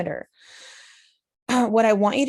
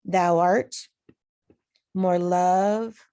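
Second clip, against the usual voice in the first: "Thou art", long pause, then "more love"; divided into iambs; 3 dB louder, much slower than usual. Shown as a demonstration. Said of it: "do the same thing".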